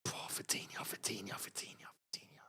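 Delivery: awed whisper. Real, not acted. Streamer marvelling at the PSG midfielder, fading whisper. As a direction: whisper the name, fading to almost nothing. Hushed awe at a player.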